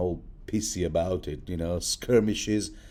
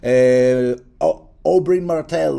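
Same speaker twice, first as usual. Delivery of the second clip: louder, higher, hammed-up Dornish accent; playful Dornish accent on "Oberyn Martell"; mock Dornish accent; 6 semitones higher, 10 dB louder than usual. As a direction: announce the name in a fake Dornish accent. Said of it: "I tend to have this Dornish accent".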